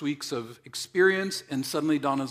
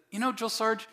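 pleading recruit; 9 semitones higher, 3 dB louder than usual. Pretend. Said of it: "What if Don said".

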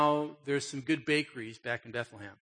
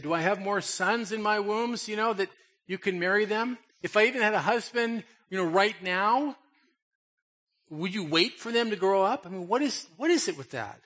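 higher, louder incredulous questions; exasperated Naomi; 5 semitones higher, 4 dB louder than usual. Retold or imitated. Preacher voicing Naomi asking about her sons, incredulous. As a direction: throw the questions at them in disbelief.

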